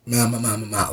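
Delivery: low nonsense syllables mimicking a narrator; low mumble. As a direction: drone meaningless syllables down low. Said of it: "or it's really".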